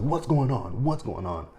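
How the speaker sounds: retold alarmed questioning, starting high and loud then easing; mock alarm. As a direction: imitate someone urgently demanding answers. Voices handcuffed Kyle's alarmed questioning.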